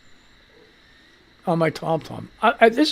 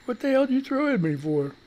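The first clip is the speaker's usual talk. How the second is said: soft, higher mock cat voice; light cat voice, hushed and higher; voicing the cat; 5 semitones higher, 8 dB quieter, faster than usual. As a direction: put words in the cat's mouth, quietly. Cat's imagined retort.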